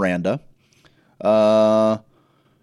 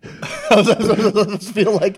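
laughing; 9 semitones higher, 5 dB louder, slower than usual. Real, not acted. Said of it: "laughs at Kiwi joke".

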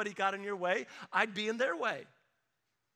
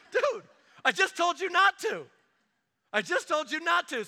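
much louder and higher, fed-up father scolding his son; exasperated dad yell; 9 semitones higher, 9 dB louder than usual. Retold or imitated. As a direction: yell it at the little boy who just touched the stand. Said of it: "I'm like".

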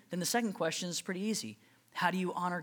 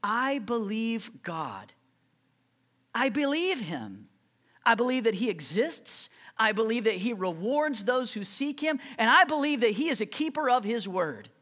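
declaration of faith; 5 semitones higher, 5 dB louder than usual. Acted. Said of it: "faith is saying".

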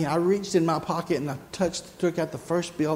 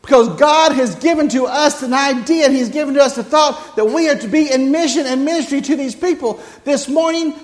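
loud, high, driving sermon voice; full-force preaching; 8 semitones higher, 9 dB louder than usual. Acted. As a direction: preach it hard to the congregation.

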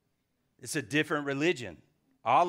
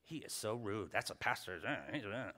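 mimicking lady; 5 semitones lower, 8 dB quieter than usual. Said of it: "She's like".

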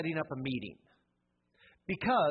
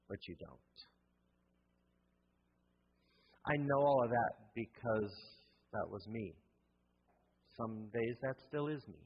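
much softer and lower, personal admission; quiet confession; 6 semitones lower, 5 dB quieter than usual. Real, not acted. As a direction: drop to a hushed, honest confession. Confessing own health failings.

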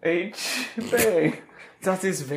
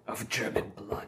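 whisper; 9 semitones lower, 12 dB quieter than usual. Acted. Hitler character whispers.

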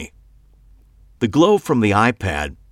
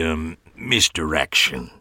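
creepy host voice; 4 semitones lower than usual. A narrator's reading.

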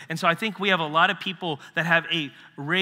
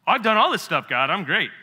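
loud, higher smug boast; boastful self-praise; 4 semitones higher, 6 dB louder than usual. Retold or imitated. Rich young man boasting to Jesus, loud and smug. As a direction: brag like you've earned it. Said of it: "boastful man answering Jesus".

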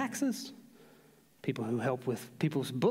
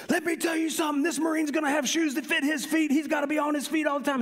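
high, raised-voice dressing-down, imitated; chewing someone out; 12 semitones higher, 4 dB louder than usual. Boot fitter to yelling drill sergeant, chewing him out. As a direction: tear into him like an outranking Marine. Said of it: "he said he just chewed him out".

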